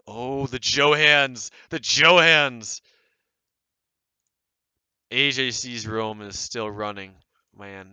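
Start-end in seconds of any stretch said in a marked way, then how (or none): 0.0-2.8 dramatic exclaim. Real, not acted. Dramatic cry at found names.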